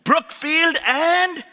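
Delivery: loud hyped church greeting; big booming welcome to the crowd; preaching at full force. Real, not acted. Preacher greeting congregation loudly.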